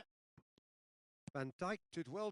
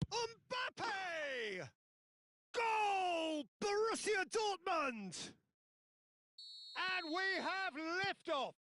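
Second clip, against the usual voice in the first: goal scream; 11 semitones higher, much slower than usual. Real, not acted. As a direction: roar the goal for the whole stadium. Commentator calls a goal.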